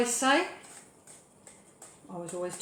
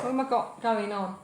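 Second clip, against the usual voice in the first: normal talking voice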